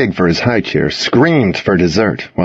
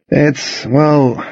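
hesitant, higher, with halting pauses; trailing off; 5 semitones higher than usual. A narrator's reading. Narrator stalls under his friends' eyes, trailing off. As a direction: let it trail away unsure of the answer. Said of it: "I trailed off, unsure of how to answer".

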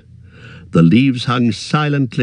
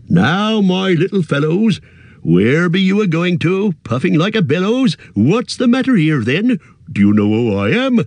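put-on high, bouncy rustic old voice; merry old country fellow; 5 semitones higher than usual. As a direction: play him jolly and bustling. Tom's voice in dialogue.

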